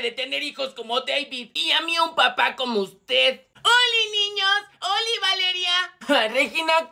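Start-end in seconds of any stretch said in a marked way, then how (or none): 3.6-5.9 high, drawn-out squealed hello; ear-splitting greeting. Acted. Regina greets her classmates in the classroom, ear-splitting. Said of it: "nos vas a dejar sordos".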